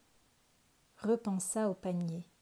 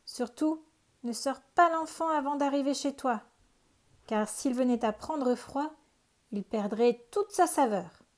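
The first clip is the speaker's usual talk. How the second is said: higher, louder sly warning; cunning witch; 5 semitones higher, 5 dB louder than usual. A narrator's reading. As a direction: trick the ogre with mock concern. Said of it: "la vieille dit au monstre".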